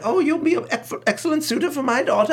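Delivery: high-pitched jolly cartoon ruler; high, giggly character impression; the Sultan. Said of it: "when the Sultan pinches his cheeks".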